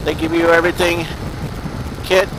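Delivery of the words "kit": usual speaking voice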